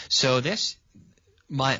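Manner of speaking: usual speaking voice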